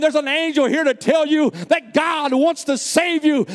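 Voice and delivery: preacher's voice climbing to full force; building preaching. Real, not acted. Preacher telling the church an angel is reaching, building preaching. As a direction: drive it upward into a fervent proclamation.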